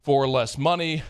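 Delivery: low, steady sponsor read. Acted. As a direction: read the ad smoothly. Reads sponsor ad.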